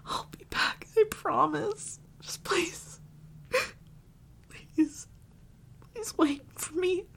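high, breath-broken crying promise; weepy, desperate plea; tearful pleading. Acted. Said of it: "guess I lost my composure".

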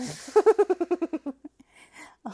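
high, very loud cackle; hyena-like cackle. Acted. After a hyena joke, loud high cackle.

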